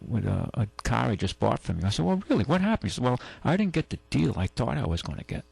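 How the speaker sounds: ordinary talk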